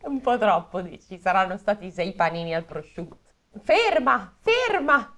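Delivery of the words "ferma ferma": urgent stop. Acted. Ottavio stopping someone.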